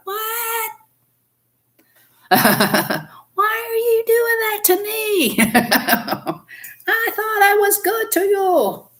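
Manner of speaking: high, slow whine, laughing through it; high exasperated whine. Retold or imitated. Griping about housemates, a high laughing whine. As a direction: squeak out a hurt complaint, laughing. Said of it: "You're like".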